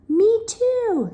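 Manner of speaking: high, loud screech. Acted. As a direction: screech it shrilly back. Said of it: "screeched Wilda".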